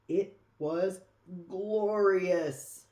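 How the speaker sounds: loud, swelling rapture on glorious; building to a loud, blissful glorious; rapturous. A narrator's reading. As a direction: let glorious burst out with delight. Savoring the bread's taste.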